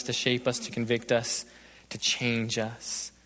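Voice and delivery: subdued prayer with long pauses. Real, not acted. Preacher leading the closing prayer, subdued and reverent. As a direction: talk humbly to God, leaving space between phrases.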